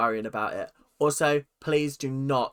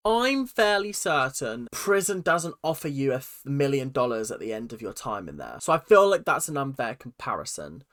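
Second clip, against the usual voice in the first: normal talking voice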